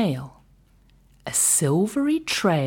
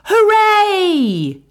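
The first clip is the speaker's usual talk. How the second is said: high, loud joyful hooray; cheering shout; 7 dB louder than usual. Acted. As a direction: cheer as the whale floats free. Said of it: "villagers shouting".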